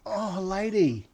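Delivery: slow, high "oh lady"; mock despairing groan; exasperated disbelief. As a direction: sigh it out in disbelief. Speaker's own disbelief retold.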